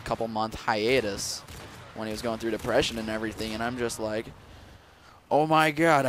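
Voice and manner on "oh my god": higher and louder, a thrilled Oh my God; excited exclaim. Retold or imitated. Spotting a YouTuber lookalike at Olive Garden, excited exclaim. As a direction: blurt it out like a starstruck fan.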